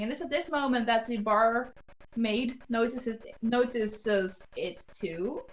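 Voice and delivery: usual speaking voice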